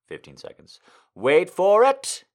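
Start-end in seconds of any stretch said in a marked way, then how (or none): 1.1-2.2 "wait for it" slow, high and loud; drawn-out suspense. Shown as a demonstration.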